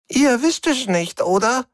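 very high, croaky little alien; croaking alien. Acted. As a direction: croak it high like a cornered stowaway. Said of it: "krächzte der Mombri".

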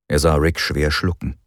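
ordinary delivery

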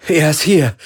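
high, loud elation; jubilant shout. A narrator's reading. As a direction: cheer the news out loud. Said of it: "jubelte Galen". (laut jubelnd)

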